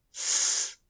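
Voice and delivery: a long hissing s with no vowel; a held, voiceless s that never stops. Shown as a demonstration. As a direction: hold the す as one unbroken hiss. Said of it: "促音の後がさ行の場合は".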